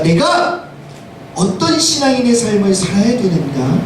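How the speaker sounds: ordinary talk, nothing marked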